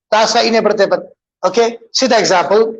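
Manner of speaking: ordinary delivery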